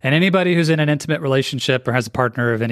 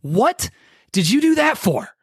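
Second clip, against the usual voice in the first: sharp and much higher, accusing; angry, attacking; 9 semitones higher than usual. Shown as a demonstration. Husband snapping at his wife over a mistake, angry and high. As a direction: spit the question out, frustrated and attacking. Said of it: "How do you think I'm gonna deliver that sentence?".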